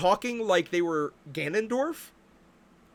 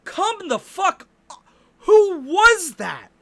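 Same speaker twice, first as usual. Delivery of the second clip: loud, slow, exasperated yelling; furious rant; 5 semitones higher, 7 dB louder, much slower than usual. Real, not acted. Gamer ranting at the Zelda trailer, loud furious yelling. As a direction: shout it out in real disbelief. Ranting about Ganondorf casting.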